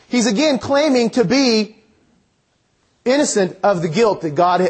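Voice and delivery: ordinary talk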